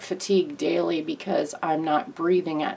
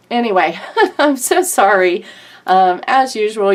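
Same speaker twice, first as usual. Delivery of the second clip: a teary laugh, louder, as the emotion breaks through; laughing through real tears; emotional; 3 semitones higher, 8 dB louder than usual. Real, not acted. Vlogger apologizing to her viewers, choked up and laughing. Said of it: "I get a little emotional".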